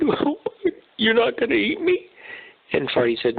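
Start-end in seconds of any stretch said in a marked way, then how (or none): 0.0-2.1 overly dramatic zebra. Acted. Zebra stuck in the mud wailing to himself, hammed-up sobbing. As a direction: wail like a hammed-up crybaby zebra. Zebra asks the lion fearfully.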